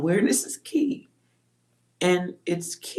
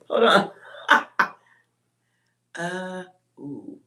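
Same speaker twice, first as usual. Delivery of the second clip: normal talking voice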